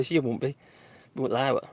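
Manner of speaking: usual speaking voice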